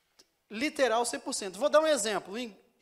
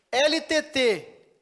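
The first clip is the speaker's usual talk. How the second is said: letters spelled out loud and slow; 4 semitones higher, 9 dB louder, much slower than usual. Real, not acted. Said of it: "Olha só".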